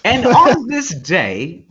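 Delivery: high, slow ceremonial proclamation; grand, drawn-out announcement; pompous colonizer. Pretend. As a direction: proclaim it loftily like a mock colonizer. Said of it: "sounds like you're about to colonize something".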